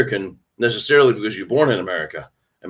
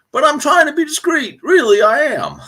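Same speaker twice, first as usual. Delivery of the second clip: high-pitched playful pleading; comically high mock-innocent insistence; mock protest; 4 dB louder than usual. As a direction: protest in a squeaky-high voice, joking. Joking protest after flag t-shirt admission.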